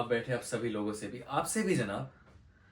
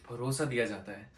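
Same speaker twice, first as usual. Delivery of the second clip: ordinary talk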